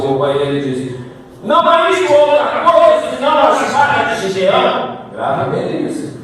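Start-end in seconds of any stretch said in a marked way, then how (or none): 1.4-5.0 higher-pitched, forceful scripture declamation; impassioned preaching. Acted. Preacher quotes the soldier interpreting the dream, impassioned. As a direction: proclaim the verse with preaching fervour.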